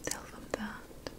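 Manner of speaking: ordinary talk, nothing marked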